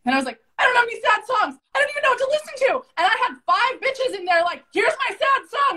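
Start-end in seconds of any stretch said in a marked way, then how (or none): 0.6-2.7 high-pitched, whiny-style retold objection; hammed-up helpless complaint; mock protest. Retold protest at crying task. 4.7-5.8 high-pitched imitation of eager helpers; mock-eager chirp; eager friends. Voices five women offering songs.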